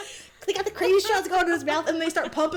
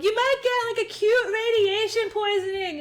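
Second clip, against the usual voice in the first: mock warning; 8 semitones higher than usual. Acting out characters' warning in retelling.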